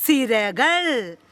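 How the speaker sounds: സിരകൾ drawn out very slowly; slow model word. Shown as a demonstration. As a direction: say സിരകൾ slowly and clearly for children to repeat. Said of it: "എല്ലാവരും ഒന്ന് ശബ്ദത്തിൽ പറഞ്ഞേ". (സിരകൾ വളരെ സാവധാനം)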